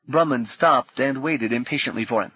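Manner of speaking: usual speaking voice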